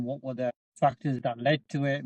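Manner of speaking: ordinary delivery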